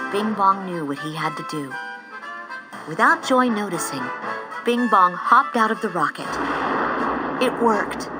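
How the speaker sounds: usual speaking voice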